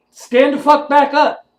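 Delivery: high, loud command to get back up; fierce shout. Real, not acted. Telling beaten-down viewers to rise, shouted. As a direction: bark it like a drill sergeant.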